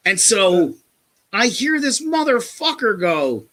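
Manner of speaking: ordinary talk, nothing marked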